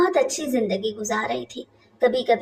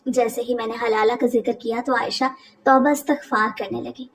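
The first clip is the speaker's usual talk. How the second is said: ordinary delivery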